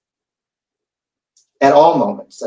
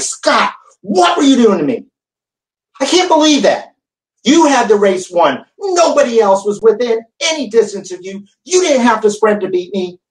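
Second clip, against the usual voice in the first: higher, indignant demands at the coach; angry confrontation; 7 semitones higher than usual. Retold or imitated. Rider confronting his coach after the race, higher and indignant. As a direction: let the outrage pour out at Scott. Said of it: "I was not happy".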